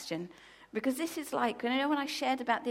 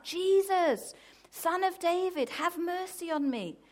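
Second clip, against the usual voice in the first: high, loud acted plea; calling out; 8 semitones higher, 5 dB louder than usual. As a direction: call out over a crowd for mercy. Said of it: "this man calls out".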